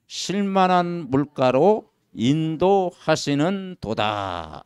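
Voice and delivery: slow, loud, measured recitation; solemn scripture reading. Acted. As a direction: read the psalm slowly and gravely. Reads psalm aloud solemnly. (천천히 장엄한 성경 낭독)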